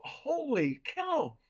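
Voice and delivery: retold high, drawn-out amazement; amazed exclamation. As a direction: stretch it out in astonishment. Amazed at hearing ghost giggling.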